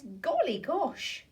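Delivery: slow, drawn-out golly gosh; sleepy startled farmer woken by a splash; surprised farmer. Acted. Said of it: "Who then says".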